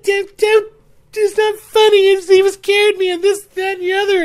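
high, loud, stammered mock protest; hysterical sputtering. Scared friend scolding the laughers, hysterical. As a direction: imitate a friend too frantic to finish.

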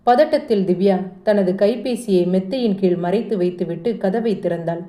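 usual speaking voice

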